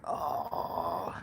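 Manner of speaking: low, soft drawn-out cringing "oh"; cringing groan. Retold or imitated.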